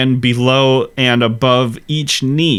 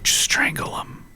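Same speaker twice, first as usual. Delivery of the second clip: hushed, voiceless aside; mock begging under the breath; pleading whisper; 6 dB quieter than usual. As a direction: whisper it as a desperate plea. Acted listeners pleading, whispered.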